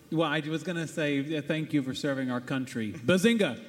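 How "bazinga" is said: lightly voiced Sheldon catchphrase; Sheldon bazinga. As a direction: punch out the catchphrase, a bit louder. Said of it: "a bazinga in true Sheldon fashion".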